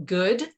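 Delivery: GOOD drawn out, lifting at the end; air-quoted GOOD. Real, not acted. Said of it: "I always use my air quotes".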